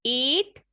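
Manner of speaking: एट very slow, ay vowel drawn out; demo word. Shown as a demonstration. Teacher modeling the ay vowel for repetition, very slow. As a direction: stretch एट so the ay glide is heard. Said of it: "मेरे साथ साथ बोलिए".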